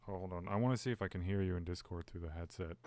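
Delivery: ordinary talk, nothing marked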